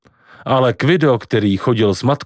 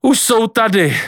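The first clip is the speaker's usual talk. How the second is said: calling out; 10 semitones higher than usual. A narrator's reading. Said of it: "zvolala babička Líba".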